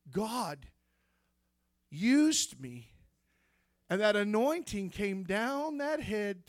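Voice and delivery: ordinary talk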